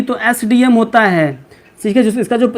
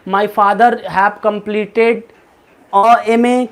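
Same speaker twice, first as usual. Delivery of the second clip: slow example sentence; much slower than usual. Shown as a demonstration. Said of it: "जैसे आप कह रहे हो कि".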